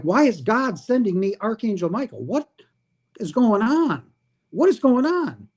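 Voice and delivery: rising panic, asking what's going on; astonished disbelief. Retold or imitated. Speaker retelling meeting an angel, astonished.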